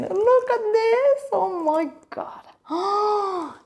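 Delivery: high squeal softening into slow awe; cooing amazement. Real, not acted. Vlogger showing viewers the bag's details, cooing amazement. Gushing over the second bag.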